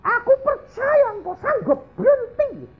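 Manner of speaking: high-pitched imitated hysterical cry to Jesus; hysterical screaming. Wife praying over her dying husband, acted hysterical screaming. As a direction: scream out a desperate prayer, acting hysterical. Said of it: "dia teriak-teriak kayak orang histeri".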